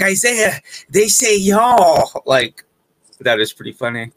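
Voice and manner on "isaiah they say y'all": high, very slow, drawn-out y'all; excited cousin. Retold or imitated. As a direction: stretch out y'all like a thrilled cousin. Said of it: "he's like".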